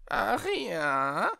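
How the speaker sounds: high, thin, sneering bat voice; mocking creature voice, watchful; lurking and mocking. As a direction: lie in wait and sneer. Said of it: "Die Antwort klang lauernd und höhnisch zugleich".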